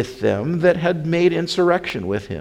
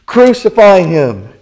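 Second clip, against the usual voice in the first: loud, raised mob shout; crying out; 6 semitones higher, 10 dB louder than usual. A narrator's reading. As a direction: yell it as a crowd demanding death. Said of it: "they cried out again".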